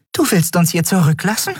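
high-pitched, incredulous question; stunned disbelief. A narrator's reading. Fairy to captain who would leave them, stunned. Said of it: "fragte Nelen fassungslos".

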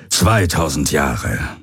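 low, slow echo; stunned commander. A narrator's reading. Commander absorbing lost centuries, low slow repetition. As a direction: repeat it heavily, letting it sink in.

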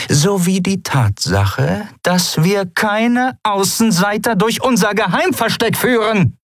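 high-pitched, mounting indignation; angry rebuke. A narrator's reading. Captain springing the trap on her mate, angry rebuke. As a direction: start slow and pointed, pitch held high to the end.

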